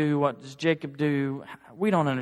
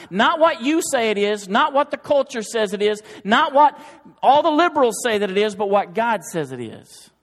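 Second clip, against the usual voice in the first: higher, louder full-force sermon; fiery preaching; 5 semitones higher, 6 dB louder than usual. Acted. Preacher warning the church about heresy, higher and louder. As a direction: thunder the warning at the pews. Building list of rebuttals.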